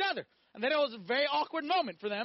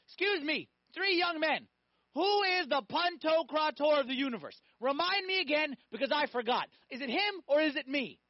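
raised, emphatic voice of God scolding; mock-stern God demanding an answer; indignant divine rebuke; 5 semitones higher, 3 dB louder than usual. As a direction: challenge them like an affronted ruler. God rebuking the three men.

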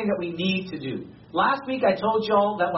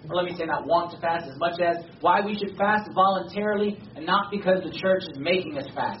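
usual speaking voice

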